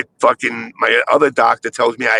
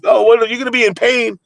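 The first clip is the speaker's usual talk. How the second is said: pitched way up, imitating the doctor; mock doctor voice; 10 semitones higher than usual. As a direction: play the doctor's warning, lightly mocking. Doctor warning him, voiced.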